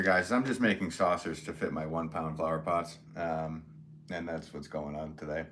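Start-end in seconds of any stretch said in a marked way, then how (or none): none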